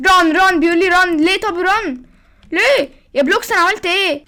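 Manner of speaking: hammed-up fear; mock panic. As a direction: panicky high-pitched complaining. Sayed panics being told run.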